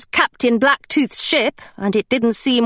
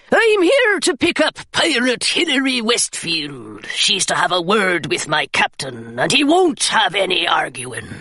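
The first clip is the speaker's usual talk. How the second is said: rough pirate; 3 semitones lower than usual. Acted. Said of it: "he cried".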